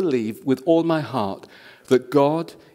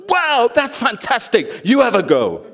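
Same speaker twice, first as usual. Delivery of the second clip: impressed hairdresser; 10 semitones higher, 6 dB louder than usual. Retold or imitated. Hairdresser gushing to her colleague, high and amazed. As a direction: gush like a hairdresser stunned by a miracle. Hairdresser voiced in retold story.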